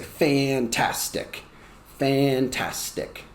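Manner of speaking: "Fantastic" split into three long beats; drawn-out syllables. Shown as a demonstration. Teacher offers another way of "Fantastic", slowly syllable by syllable. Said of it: "Or you can say".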